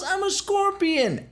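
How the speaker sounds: high and slow, a deadpan shrug of a line; smug scorpion. Retold or imitated. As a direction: say it like it's the most obvious thing. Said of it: "He goes".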